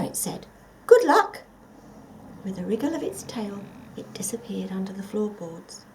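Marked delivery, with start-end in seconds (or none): 0.9-1.4 high, loud squeaky little mouse voice. Acted. Mouse warning the forgotten creature, high and squeaky. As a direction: pipe it up like a busy little mouse.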